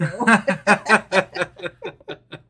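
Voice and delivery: light laugh. Real, not acted. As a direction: laugh at the story. Laughs after story.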